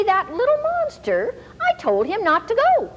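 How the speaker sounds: put-on half-laughing fury at a naughty kid; exasperated mom. Mother sees son walking to the store, mock outrage.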